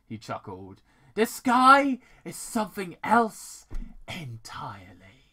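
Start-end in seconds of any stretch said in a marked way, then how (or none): 1.2-5.3 loud stage whisper, no voice; intense whisper. Acted. Villain whispering, thrilled by the strange creature. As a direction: whisper it like a gleeful discovery.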